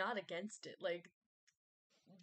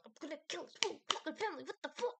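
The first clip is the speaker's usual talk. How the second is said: high-pitched, fast goofball rant; Valentino; 7 semitones higher, much faster than usual. Retold or imitated. Vlogger imitating Valentino's silly introduction, goofy. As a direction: rattle it off high like a goofy villain. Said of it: "in this goofy kind of way".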